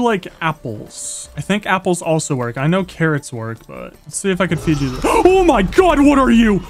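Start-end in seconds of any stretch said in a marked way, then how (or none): 5.0-6.6 gasp, then high loud alarm; real jump-scare yell; startled shriek. Real, not acted. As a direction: cry out high at a sudden monster. Startled by a creature in game.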